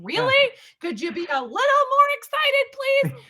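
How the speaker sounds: high, loud mock pleading; exasperated plea. DJ pleading with a flat winner, high and loud. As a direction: beg them to show some excitement. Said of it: "And you're like".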